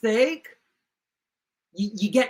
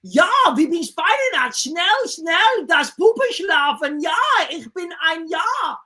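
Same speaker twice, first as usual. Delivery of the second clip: higher, faster fake German gibberish; mock German; 6 semitones higher than usual. German crew panic over the mistake, mock German accent. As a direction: babble in hammed-up cod German. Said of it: "are like".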